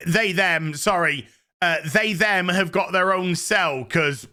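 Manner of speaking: usual speaking voice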